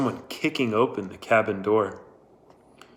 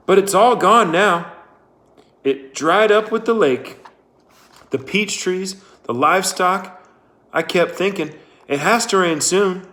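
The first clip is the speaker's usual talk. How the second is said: high, louder anxious telling; desperate Linda; 7 semitones higher, 5 dB louder than usual. Acted. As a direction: spill it out in distress.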